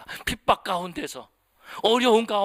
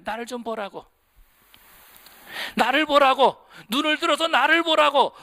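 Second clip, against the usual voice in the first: calling out; 5 semitones higher, 5 dB louder than usual. Retold or imitated. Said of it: "우리에게 소리치고 계시는 거예요". (부드럽게 시작해 크게 외치는 주님의 부르심)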